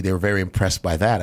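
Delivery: ordinary talk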